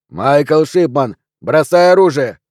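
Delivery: loud, drawn-out order; police command. A narrator's reading. Policeman ordering Michael to drop the weapon, loud. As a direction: order him loudly and slowly to disarm.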